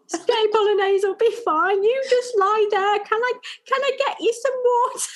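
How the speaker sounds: a kind son soothing his mum; sweet little boy. Retold or imitated. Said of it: "he stroked my hair and he went".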